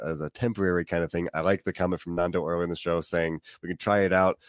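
normal talking voice

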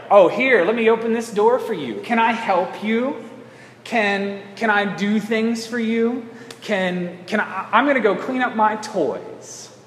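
put-on high-pitched, sugary helpful child; eager-to-please kid. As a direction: offer help sweetly in a high, slow child voice. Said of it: "go out of their way to be nice".

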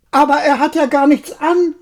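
put-on high, loud child's voice; child calling out. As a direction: call out innocently like a small child. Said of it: "rief plötzlich ein kleines Kind".

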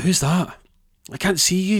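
high, loud, bewildered demand; alarmed questioning. Acted. Strapped man to an unseen speaker, high and alarmed. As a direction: call out to someone you cannot find.

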